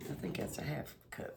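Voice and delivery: whispered aside; whispering. Real, not acted. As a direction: whisper it to yourself. Checking the measuring cup aloud.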